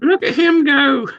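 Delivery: put-on high old-timer voice; old man voice. Playing the old ghost.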